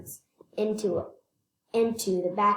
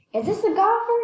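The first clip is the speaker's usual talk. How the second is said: high, louder puzzled question; curious president; 12 semitones higher, 4 dB louder than usual. A narrator's reading. President to driver, high curious question. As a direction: voice the president peering at the cage. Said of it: "he asked".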